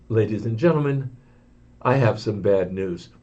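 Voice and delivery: a worried pilot over the intercom; apprehensive pilot. Retold or imitated. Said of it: "you could hear it in his voice, the apprehension".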